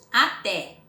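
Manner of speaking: Até with a loud final TÉ; stress on TÉ. Shown as a demonstration. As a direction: push the last syllable of Até. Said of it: "que é a mais forte".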